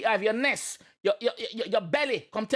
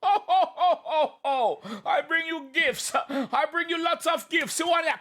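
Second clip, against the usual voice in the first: high, slow, laughing jolly Santa; 7 semitones higher than usual. Pretend. Riffing on the Santa lyric, high laughing Santa voice. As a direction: ham up a jolly Santa handing out gifts. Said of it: "climbing in your yard like I'm Santa".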